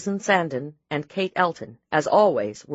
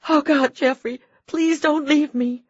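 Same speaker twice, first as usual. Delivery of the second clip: desperate begging; 11 semitones higher, 4 dB louder than usual. A narrator's reading. Wife begs dying husband.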